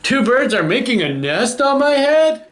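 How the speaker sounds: high, alarmed question; worried elephant. Acted. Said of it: "The elephant's really worried".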